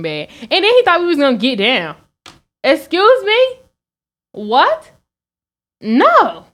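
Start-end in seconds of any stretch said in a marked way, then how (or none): 2.6-3.6 much higher, louder indignant excuse me; shocked, loud excuse me; outraged excuse me. Retold or imitated. Date thought they'd hook up. 4.3-6.4 higher, louder what and no; shocked loud no; incredulous refusal. Retold or imitated. Rejecting date's advance.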